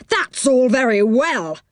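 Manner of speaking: high-pitched indignant retort; angry man. A narrator's reading. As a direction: cut off an apology crossly. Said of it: "said the angry man".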